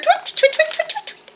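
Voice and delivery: very high, short tweets; chittering starlings. A narrator's reading. Narrator voices starlings near Owl, high chittering. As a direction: chitter rapidly like small birds. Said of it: "The starlings chittered".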